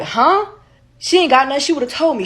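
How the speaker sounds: high, loud startled huh then insistent; a guy suddenly scared he's infected; panicked denial. Retold or imitated.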